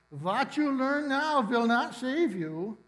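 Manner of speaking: a stern German professor's slow warning; German accent. Retold or imitated. Professor to students still cramming, German accent. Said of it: "in that delightful accent".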